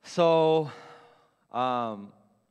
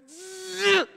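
strained squeal; 14 semitones higher than usual. Retold or imitated. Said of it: "mid me going".

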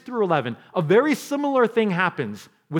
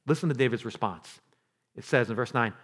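usual speaking voice

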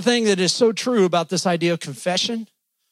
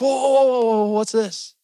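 startled dog; 3 semitones higher than usual. Retold or imitated. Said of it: "They're like".

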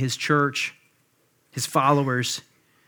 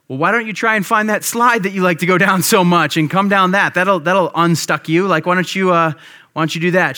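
raised, higher voice, acting out a coaxing dad; dad calling up; 4 semitones higher, 5 dB louder than usual. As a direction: call up encouragingly to a child out of sight. Dad calling up to stuck child.

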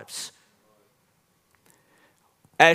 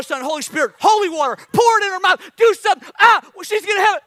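high, loud, fast hammed-up freakout; mock panic; 9 semitones higher, 6 dB louder than usual. Pastor acting out his panic as baby licks the table. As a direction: shout it like a frantic dad.

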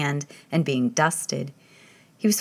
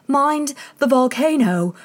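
high, slow, louder call; 8 semitones higher, 5 dB louder, slower than usual. Acted. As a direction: shout a warning across the room.

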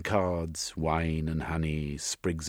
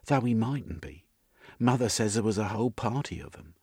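higher, quick woman's voice; matter-of-fact woman character; 5 semitones higher than usual. Acted. Missus speculating about her father, higher and quick. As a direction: toss it off briskly in her voice.

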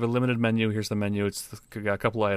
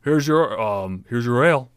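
gruff barkeep; 2 semitones higher, 8 dB louder, slower than usual. Acted. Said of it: "Bar comes up and goes".

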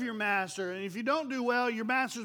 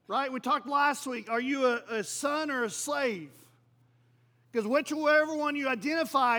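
ordinary talk

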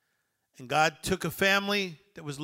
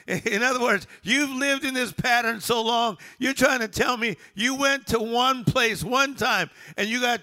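loud, high-pitched, driving sermon delivery; rousing preacher building question on question; full-force preaching; 7 semitones higher, 5 dB louder than usual. Real, not acted. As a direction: preach it out to the whole congregation, voice raised.

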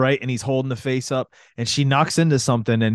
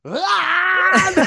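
high, sustained mock scream; high-pitched charging yell, played for laughs; imitated battle scream. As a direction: burst into a long imitation war cry. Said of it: "He starts screaming and running".

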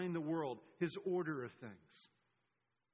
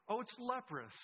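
higher-pitched, acted discovery; a raised-pitch "oh" of playful astonishment; mock surprise; 6 semitones higher than usual. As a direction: react as if just seeing it. Acting out reaction to the sign.

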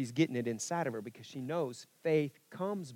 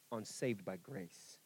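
"saved" leaned on, slow and softer; stress saved by grace; 6 semitones lower, 10 dB quieter, slower than usual. Shown as a demonstration. Preacher showing God's emphasis, soft and slow. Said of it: "God wants the emphasis".

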